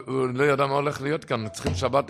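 ordinary talk, nothing marked

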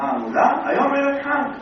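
high, loud exclaim; amazed townsfolk, loud and high; incredulous answer. Acted. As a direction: answer as if it's obvious. Neighbours answer the rabbi.

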